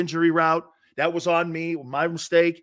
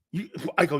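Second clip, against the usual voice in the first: ordinary talk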